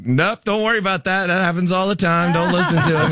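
breezy, brushing it off as the nurse; a hammed-up nurse reassuring the wife; brisk nurse. Nurse voiced dismissing the husband.

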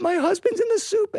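high-pitched mock wail; crying. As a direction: wail it like a comic widow. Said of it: "Why are you all crying?".

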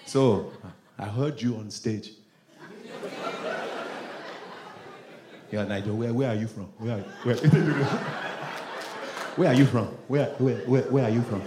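suspicious Nigerian questioner; Nigerian accent. Retold or imitated. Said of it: "Even Nigerians be testing me".

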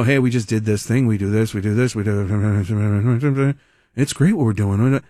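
hammed-up bored incumbent; low energy. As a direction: imitate a sleepy mayor shrugging it off. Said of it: "Sounds very, like, low energy".

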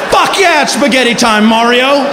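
high, loud excited cheer; joyful high yell. Retold or imitated. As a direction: cheer it back at the host. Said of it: "And you're like".

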